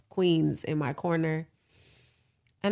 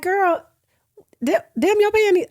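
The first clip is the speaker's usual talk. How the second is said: excited; 13 semitones higher, 7 dB louder than usual. Retold or imitated. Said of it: "I was like".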